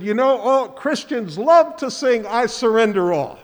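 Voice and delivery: loud, high Southern preacher impression; rough attempt at a Georgia Baptist preacher's accent; imitated Southern Georgia accent. Pastor imitating a visiting Baptist preacher, loud Southern accent. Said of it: "all in that Southern Georgia accent".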